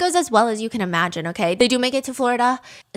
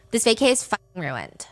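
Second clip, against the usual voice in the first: ordinary delivery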